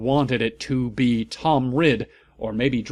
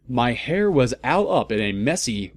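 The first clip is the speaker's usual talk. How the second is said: ordinary delivery, nothing marked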